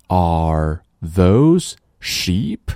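very slow rising question for a listening test; slow dictation question. Shown as a demonstration. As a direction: read it slowly so pupils can pick out "sheep". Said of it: "我来读，然后你告诉我". (极慢的听力示范问句)

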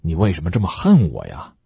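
ordinary delivery

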